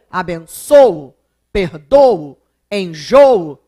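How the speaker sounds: abençoo, perdoo, enjoo punched loud on the first o, then falling; loud, drawn-out ÔÔ-o ending on abençoo, perdoo, enjoo; stress on first O. Shown as a demonstration. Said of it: "acento circunflexo no primeiro O".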